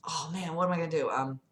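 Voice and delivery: plain reading voice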